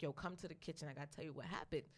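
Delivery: low confiding voice; quiet. Retold or imitated. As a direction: pull her aside and speak low. Sister pulls her aside.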